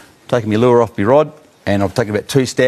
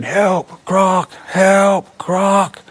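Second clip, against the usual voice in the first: retold yell for help; 9 semitones higher, much slower than usual. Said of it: "I started yelling out again".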